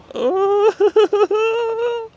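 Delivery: long, high-pitched giggle; high squealing laugh. Real, not acted. Mocking the ugliest car with a friend, high squealing laugh.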